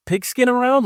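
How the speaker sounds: mock Disney-movie son, way up high; Disney movie kid. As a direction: play the corny sitcom son asking his pops. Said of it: "out of a Disney movie".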